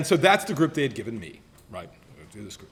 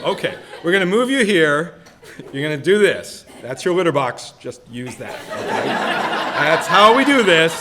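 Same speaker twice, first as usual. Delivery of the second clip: loud, sing-song coaxing of a pet; cat-training voice; 8 dB louder than usual. Retold or imitated. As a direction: praise the cat like a proud owner, laughing. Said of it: "She's just training a cat".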